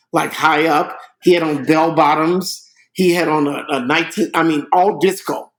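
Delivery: normal talking voice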